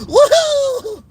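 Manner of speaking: high, loud mock whoop; mock cheer. Vlogger mocking celebrating fans, high loud woohoo. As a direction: whoop like a giddy rival fan.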